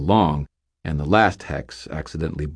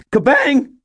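very high, loud shout; panicked spell shout; 7 dB louder than usual. Acted. Startled wizard whirls and shouts his spell. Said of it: "whirled in panic and shouted".

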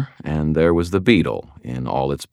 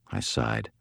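usual speaking voice

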